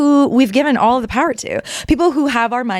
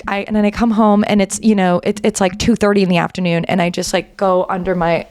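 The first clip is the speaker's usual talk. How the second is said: ordinary talk, nothing marked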